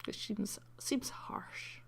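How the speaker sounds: soft unvoiced aside, under the breath; hushed rueful aside; whisper. Real, not acted. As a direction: whisper it like a private grumble. Aside muttered softly over the game.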